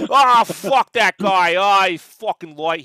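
shouted, slow gruff New York voice; Pat Duffy. Acted. Mimicking shop owner who hated Liefeld, gruff bellow. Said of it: "I'll do the voice".

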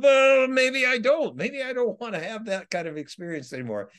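mock indignant refusal of the spirits; defiant retort. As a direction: push back hard, dragging out the opening. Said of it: "they're like".

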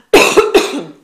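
sudden loud cough into the mic; coughing fit. Real, not acted. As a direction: clear the throat with a hard cough. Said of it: "ich muss kurz husten".

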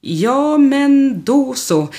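high, slow, squirming "Ja, men då så"; embarrassed hesitation. Acted. Staffan squirming when Klaus teases him, high and drawn out. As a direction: drag it out, bashful and caught out. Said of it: "skruvar på sig".

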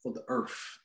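hushed whisper. Pretend. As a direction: whisper it like a vow. Mock solemn earth line.

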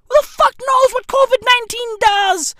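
high, loud ranting question; loud, high incredulous rant; comic shout. Acted. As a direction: yell it out in disbelief.

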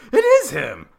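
high, loud eureka cry; thrilled discovery. Retold or imitated. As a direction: re-enact an exultant fan recognizing someone. Acts out confirming the wrestler's identity.

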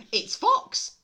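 very high, loud squeak; high, loud cartoon mouse; chirpy mouse. Mouse's line in the story.

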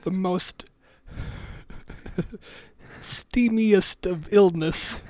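put-on olden-English pomp; mock Shakespearean. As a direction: draw out the grand diagnosis. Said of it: "like, Shakespeare and shit".

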